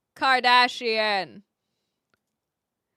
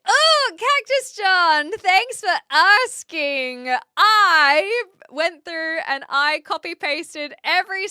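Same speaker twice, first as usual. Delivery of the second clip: excited; 5 semitones higher, 7 dB louder than usual. Real, not acted. Thanking chatter.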